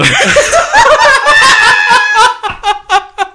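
high, loud burst of helpless laughing; loud laughter. Real, not acted. Hosts joking over a botched accent, long high laughing fit. Friend concedes accent might be Australian.

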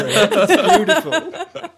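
slow laughing, high. Real, not acted. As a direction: stretch it out mid-laugh. Mock sentimental.

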